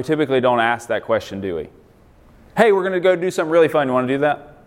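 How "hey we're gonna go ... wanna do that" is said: mock cheerful invite. Mock invitation to something fun.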